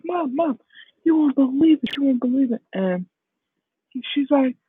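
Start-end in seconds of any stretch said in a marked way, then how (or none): 0.0-2.6 excited son. Acted. Acted son excitedly telling mom news.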